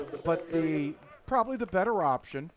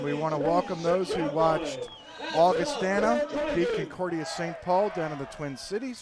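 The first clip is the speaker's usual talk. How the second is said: normal talking voice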